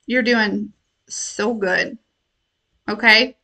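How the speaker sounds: slow, soft, tender encouragement; reassuring. Real, not acted. Speaker reassuring her viewers, slow soft tender voice. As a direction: tell them gently they're fine.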